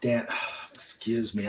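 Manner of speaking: normal talking voice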